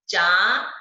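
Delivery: चांद loud, with चा drawn out through the nose; stressed nasal vowel. Shown as a demonstration. Teacher demonstrating the chandrabindu in चांद, stressed nasal vowel. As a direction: lean hard on the nasal चां in चांद. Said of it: "तो उच्चारण कैसे करेंगे".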